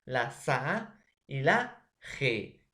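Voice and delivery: "la pa, la sa y la G" spelled out slowly, each syllable apart; slow classroom syllable count. Shown as a demonstration. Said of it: "una sílaba va a ser la P".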